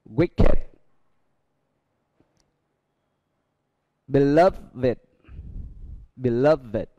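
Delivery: "Beloved" and "Sacred" ending in id. Shown as a demonstration. Teacher drilling adjectives for the class, ed as id. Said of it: "E D được đọc là it".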